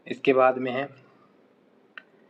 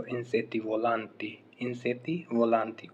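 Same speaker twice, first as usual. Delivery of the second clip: slow model phrase; much slower than usual. Shown as a demonstration. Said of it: "उड़ने वाले कीड़े".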